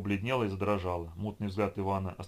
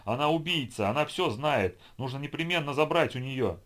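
louder, higher frantic accusing; frantic accusation; 6 semitones higher, 4 dB louder than usual. A narrator's reading. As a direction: scream the accusation while struggling. Madman struggling, accusing.

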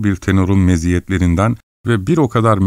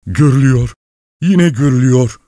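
terrified; 6 semitones higher, 4 dB louder than usual. A narrator's reading. Sergey sees the ghost, terrified.

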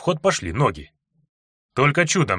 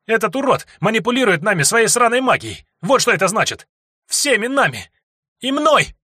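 выкрик; 11 semitones higher, 3 dB louder than usual. Acted. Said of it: "выкрикнула Анька".

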